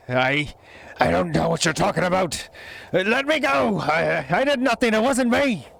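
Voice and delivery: high, frightened goblin denial; scared goblin. Acted. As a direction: stammer out a panicked denial. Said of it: "hears your voice".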